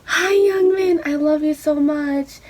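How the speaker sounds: high, loud, giddy fangirl squeal; freaking out. Retold or imitated. Fan freaking out greeting [name], high giddy squeal. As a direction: gush it out like meeting your bias. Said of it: "freaking out".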